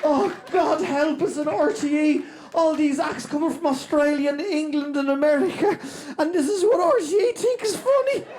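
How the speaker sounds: high, pleading mock despair; exasperated rant.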